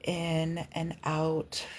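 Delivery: drawn-out, slow breath guide; slowly pace the listener's breathing in and out; slow breathing cue. Shown as a demonstration. Coach guides viewers through breathing, slow and drawn out. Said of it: "Use your breath".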